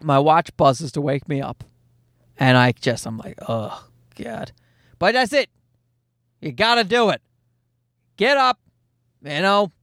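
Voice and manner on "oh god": low, soft, half-asleep groan; a low, soft groan at the alarm; groggy groan. Retold or imitated. Dad woken by his watch at three a.m., groggy groan.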